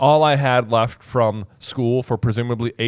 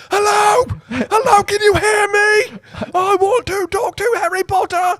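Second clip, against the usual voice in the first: very high, loud, drawn-out phone shout; Ron; 4 dB louder than usual. Acted. Wizard boy phoning his friend's uncle, shouting. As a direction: yell slowly as if the line can't carry it. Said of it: "And then Ron just responding".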